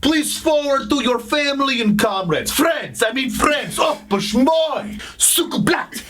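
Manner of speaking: mock Russian accent, comic asides; hammed-up Russian accent. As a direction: stay in the Russian spy voice. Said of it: "That accent is freaking crazy".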